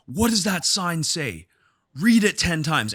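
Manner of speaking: imitating a dad yelling at his crying daughter in class; retold yelling father. Gym owner imitating a dad yelling at his daughter, mock yell. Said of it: "he starts yelling".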